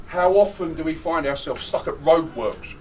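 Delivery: usual speaking voice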